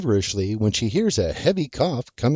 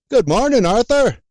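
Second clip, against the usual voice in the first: loud, high cheerful call across the yard; hollering; 11 semitones higher, 6 dB louder than usual. Acted. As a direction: holler a greeting from afar. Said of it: "and hollers".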